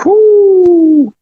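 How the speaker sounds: a loud, high whoop of celebration; excited whoo. Real, not acted. Host cheering after the caller hangs up, loud high whoop. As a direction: let out a big joyful whoo.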